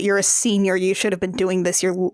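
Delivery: usual speaking voice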